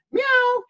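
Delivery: very high, thin meow; cat meow. Retold or imitated. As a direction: mew like a small stray cat. Imitating a stray cat.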